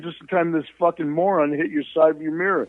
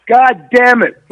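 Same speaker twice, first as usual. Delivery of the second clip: higher and much louder angry curse; mock angry shout; 6 semitones higher, 8 dB louder, slower than usual. Dad cursing after the car won't move, loud mock shout. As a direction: belt it out like the furious dad. Dad's curse third time.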